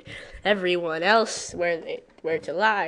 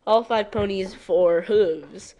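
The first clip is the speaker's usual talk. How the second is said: usual speaking voice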